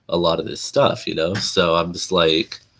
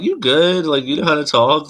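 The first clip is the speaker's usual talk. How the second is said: high-pitched, louder mock query; imitated doubters, pitched way up; mock questioning; 9 semitones higher, 4 dB louder than usual. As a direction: quote them in a raised, incredulous pitch. Acting out people doubting their speech.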